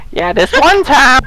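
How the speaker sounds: very high-pitched caricature of a woman reminiscing; mock grandmother storyteller. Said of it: "talking to her grandchildren".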